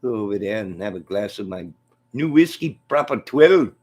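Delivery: boastful Irish fighter impression; McGregor. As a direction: crow about your own whiskey.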